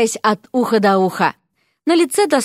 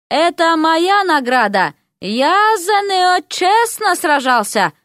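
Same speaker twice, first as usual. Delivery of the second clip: indignant shout; 7 semitones higher, slower than usual. Acted. Prince claims the bride.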